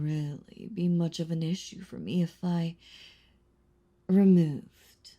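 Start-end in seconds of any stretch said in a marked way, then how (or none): 4.1-5.1 removed punched out loud; stress on removed. Acted. Vampire threatening to kill the hunter's friends, loud stress on removed. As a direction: hit the word removed hard and loud.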